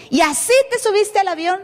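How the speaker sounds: a touch of the agent's outrage; incredulous scolding. Retold or imitated. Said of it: "me gritó".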